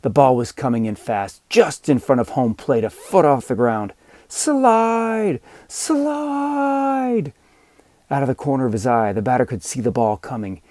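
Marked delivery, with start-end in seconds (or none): 4.3-7.3 high, drawn-out yell for the runner. Acted. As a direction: call him to slide, long and high. Kids yelling at runner.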